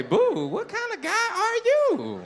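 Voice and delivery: very high, slow mock outrage; mock shocked. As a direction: squeal at the heckler in fake shock. Mocking heckler's pet name.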